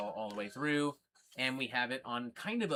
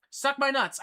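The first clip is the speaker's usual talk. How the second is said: much higher and louder, spat out; louder defiant repeat; 10 semitones higher, 6 dB louder than usual. Real, not acted. Telling off the troll in chat, loud defiant repeat. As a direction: hit it again, harder and higher.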